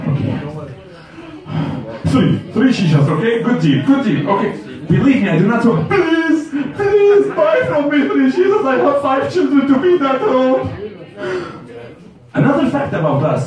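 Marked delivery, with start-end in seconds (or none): 2.1-10.6 much higher pleading cry; hammed-up pleading vendor; desperate begging seller. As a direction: beg him to buy the shishas.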